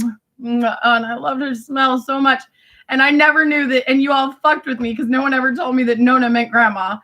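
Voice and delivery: ordinary talk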